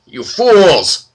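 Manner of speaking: loud, high spat insult. Acted. Old minotaur spits at the captured heroes, contemptuous. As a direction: spit the words out in contempt. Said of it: "spits the Minotaur".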